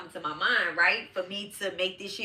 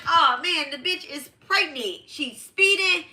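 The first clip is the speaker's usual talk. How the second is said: mock shock; 8 semitones higher, 3 dB louder than usual. Shocked realization mid crash story.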